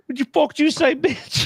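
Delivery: mock kangaroo thug. Voicing the kangaroo confronting him.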